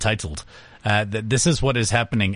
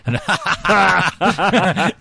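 high, loud burst of laughter; real laugh; 5 semitones higher, 4 dB louder than usual. Real, not acted. Host laughing at his punchline about firing him. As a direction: laugh out at your own joke.

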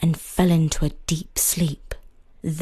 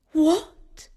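high surprised wife voice; wife's exclaim; 9 semitones higher than usual. A narrator's reading. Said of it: "exclaimed his new wife".

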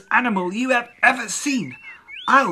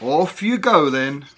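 father voice; 2 semitones lower, 4 dB louder than usual. Acted. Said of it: "Andrew's father walked into the kitchen".

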